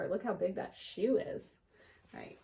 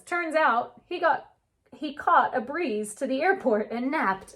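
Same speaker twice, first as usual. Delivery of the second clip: gentle storybook read-aloud; unhurried picture book narration; reading to children; 3 semitones higher, 5 dB louder than usual. A narrator's reading. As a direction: read it like story time for kids.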